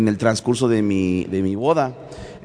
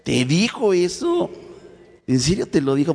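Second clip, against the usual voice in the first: stunned, drag out the question; shocked disbelief; 7 semitones higher than usual. Retold or imitated. Man learning his sister told the family, asks in shocked disbelief. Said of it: "mi reacción fue".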